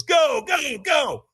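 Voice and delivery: high-pitched shouted send-off; urgent command. Retold or imitated. Trainer sends the man onto the coals, retold urgent shout. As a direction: fire off repeated goes to launch someone.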